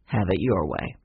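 low, resigned grandfather voice; slow reply. A narrator's reading. Grandfather gives in to his grandchildren, slow and low. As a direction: give in slowly, pitched well down. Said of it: "he said slowly".

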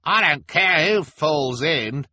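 high, slow shout; loud announcement. Acted. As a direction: announce it to the whole crowd. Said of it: "announced the pimpled man".

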